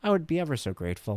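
put-on high, prissy little-girl voice; prim polite child. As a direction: play the impossibly polite daughter. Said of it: "Wouldn't that be great if she said that".

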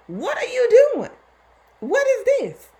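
high, loud, desperate plea to God; anguished cry to God. Retold or imitated.

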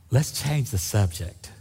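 slow, offhand dodge; a hint of a caught woman changing the subject; evasive. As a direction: brush it off slowly. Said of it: "And she's going".

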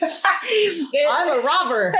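high, slow silly confession; mock robber. Co-host joking as the intruder, high silly robber voice. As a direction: answer high and drawn out, playfully.

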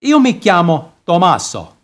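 put-on heavy Italian accent. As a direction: talk like an Italian barber. Italian barber throughout.

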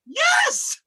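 very high, drawn-out shout; high insistent exclamation; emphatic yes. Real, not acted. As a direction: squeal it in mock outrage. Defending pronunciation in mock fight.

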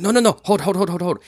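high, urgent pleading; begging not to drop it; panicked local. Acted. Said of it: "He's like".

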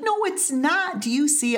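imitating herself arguing with her husband, high insistent; insistent disbelief. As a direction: squeak it out in retold protest. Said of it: "And I'm like".

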